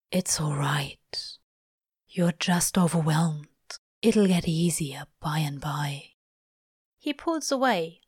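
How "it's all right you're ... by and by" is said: low, husky reassurance; soothing. A narrator's reading. Crowley calming the overwhelmed angel, soothing. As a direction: soothe him gently in a low voice.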